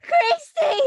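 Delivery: loud high call of a name; urgent warning shout. Acted. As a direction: yell her name in alarm. Characters shouting warning in scene.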